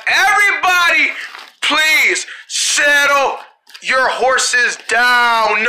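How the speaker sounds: very slow, drawn-out settle down; slow calming. Acted. Leader calming panicked kids, very slowly. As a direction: stretch every word to calm them. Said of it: "calming the group, drawn out".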